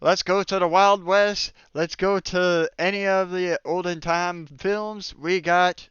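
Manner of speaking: usual speaking voice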